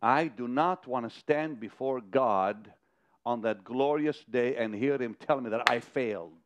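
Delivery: ordinary talk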